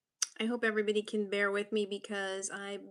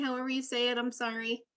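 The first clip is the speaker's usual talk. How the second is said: normal talking voice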